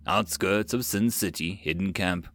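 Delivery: usual speaking voice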